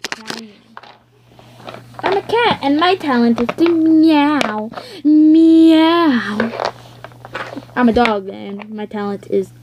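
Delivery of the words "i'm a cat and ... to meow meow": high cat voice with loud meow. Pretend. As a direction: purr it like a kitten. Cat toy.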